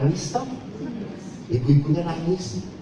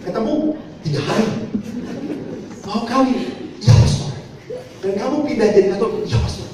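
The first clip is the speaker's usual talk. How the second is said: mock astonished priest questioning; mounting disbelief; 9 semitones higher than usual. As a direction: pile on the shock with each answer. Priest and woman, rising disbelief.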